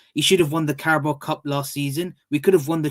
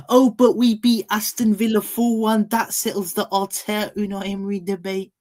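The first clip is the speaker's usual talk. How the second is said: higher-pitched mock fan voice; mocked Top Gooners; 6 semitones higher than usual. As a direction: mimic a fan's smug argument, pitched up. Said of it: "Top Gooners are gonna go".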